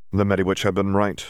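usual speaking voice

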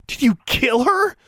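high, horrified question rising at the end. A narrator's reading. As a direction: ask it aghast, voice shooting up. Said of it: "she asked, horrified".

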